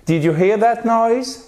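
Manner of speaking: high, climbing pitch up to "noise"; rising question intonation. Shown as a demonstration. Teacher repeats the yes-or-no question, rising intonation. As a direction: make "Did you hear that noise" rise as a yes-no question. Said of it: "On fait remonter l'intonation".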